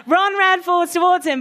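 plain reading voice

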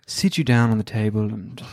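low, measured posh British doctor; high-class British accent. Shown as a demonstration. Surgeon seating the patient, high-class British accent. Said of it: "a high-class British accent".

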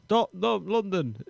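mock grand announcement. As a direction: announce it like a big launch.

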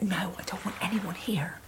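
whispered plea to be left alone; retold tearful whisper. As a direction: drop to a tiny whisper, acting upset. Retelling crying backstage, refusing company.

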